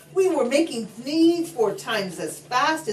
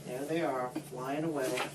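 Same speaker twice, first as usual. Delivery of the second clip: lower, softer pointing at the page; soft aside; 7 semitones lower, 11 dB quieter than usual. Real, not acted. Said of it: "aside to the child listener".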